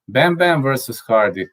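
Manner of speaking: ordinary delivery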